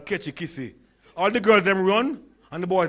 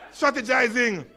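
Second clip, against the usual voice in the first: normal talking voice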